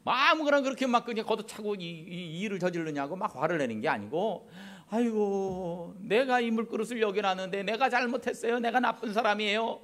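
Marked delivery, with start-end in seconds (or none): 4.9-9.8 higher, lightly voiced humble wife taking the blame; apologetic wife. Wife apologizing to husband over spilled water, mildly humble higher voice. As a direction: sigh it out like a gentle wife owning her mistake. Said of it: "그렇게 말을 한다는 겁니다".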